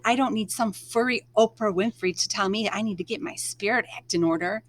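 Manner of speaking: ordinary delivery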